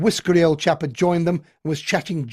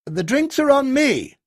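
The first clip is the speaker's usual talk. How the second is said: placating offer; 11 semitones higher, 5 dB louder than usual. A narrator's reading. Colonel wins crowd over.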